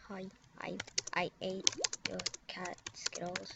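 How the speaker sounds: hushed breathy whisper. Pretend. Whispering hi before telling a player about the cat. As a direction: barely breathe it out.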